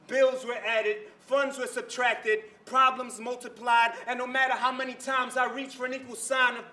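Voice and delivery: spoken-word poem. Acted. Poet telling a crowd of broken families, driving spoken word. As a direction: perform it as urgent rhythmic slam poetry.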